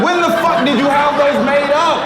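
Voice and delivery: high, loud shocked shout; yelling at the friend over the memorial shirt, high and loud; incredulous shout. Retold or imitated. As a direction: shout it, stunned and laughing. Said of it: "I'm like".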